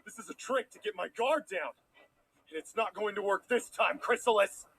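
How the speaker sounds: quiet defiant threat. Acted. Prince accuses in low voice.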